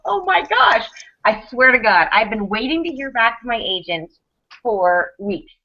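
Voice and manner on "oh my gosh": high-pitched retold freak-out; mock freak-out. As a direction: gasp it high, acting out the panic. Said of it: "I'm like, I'm freaking out".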